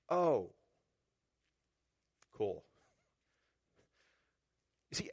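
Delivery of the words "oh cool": lower, deadpan sarcastic cool; sarcastic aside after a grim prophecy; dry sarcasm. Real, not acted. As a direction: say it like it's anything but cool. Sarcastic reaction to doom message.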